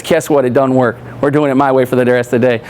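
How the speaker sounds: lightly voiced fed-up driver, pitched low; dismissive driver. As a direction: imitate a driver refusing, voice dropped low. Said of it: "takes his card and he throws it off".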